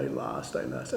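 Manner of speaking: soft, breathy imitation of sobbing words; retold crying. Former student tells teacher she lost, tearfully. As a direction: hush it out like a tearful young woman. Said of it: "she was crying and crying and crying".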